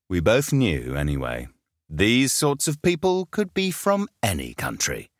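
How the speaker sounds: ordinary delivery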